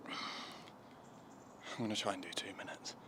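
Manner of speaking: cold, breathless whisper. Real, not acted. Sitting in ice bath.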